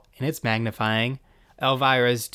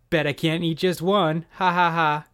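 mock laugh; 5 semitones higher than usual. Elvira quips and laughs.

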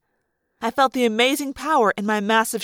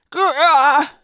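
loud growl breaking into a roar; 3 semitones higher, 6 dB louder than usual. Acted. Girl morphing into a grizzly bear, growling. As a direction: lose the words in an animal growl.

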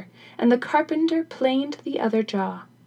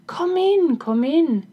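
higher, louder call to the door; 6 semitones higher, 4 dB louder, slower than usual. Acted. King answers Granny's knock, calling out. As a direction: call to someone outside the room.

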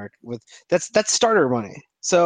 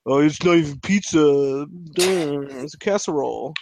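mocking New Yorkers. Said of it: "They're like".

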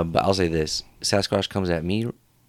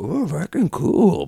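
high, loud impressed creature; put-on beast voice, drawn up high; Sasquatch voice; 8 semitones higher, 4 dB louder, much slower than usual. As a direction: play a delighted Sasquatch. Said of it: "He'll be like".

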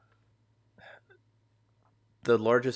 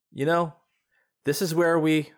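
higher bright voice, forced cheer; a grieving woman putting on a brave face; grieving friend; 7 semitones higher, 6 dB louder than usual. Acted. Ariana reminiscing.

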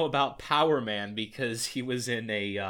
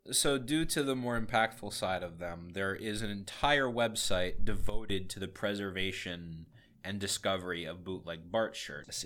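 ordinary delivery

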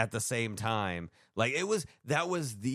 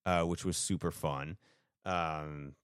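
ordinary talk, nothing marked

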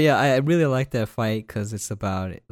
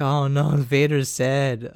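mock pity; 5 semitones higher, 5 dB louder, slower than usual. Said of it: "I'm like".

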